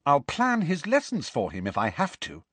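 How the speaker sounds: high, insistent resolve; determined. A narrator's reading. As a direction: vow it firmly, building in force. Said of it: "said Hermione determinedly".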